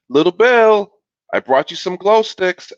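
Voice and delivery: loud, high sing-out to a little brother; sister calling out. A narrator's reading.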